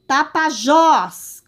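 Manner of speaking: "Tapajós" said slowly and loudly, leaning hard on "jós"; stressed last syllable. Shown as a demonstration. Teacher showing pupils the strongest syllable, "Tapajós" with "jós" long and loud. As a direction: stretch and punch the end of "Tapajós". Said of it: "sílaba que eu falo com mais intensidade".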